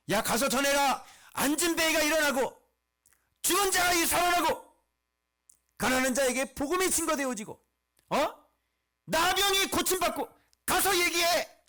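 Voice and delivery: high, loud, emphatic command; commanding proclamation. Acted. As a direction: proclaim each item like an urgent order. Acting out Jesus sending messengers back.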